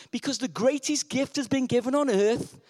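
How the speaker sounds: usual speaking voice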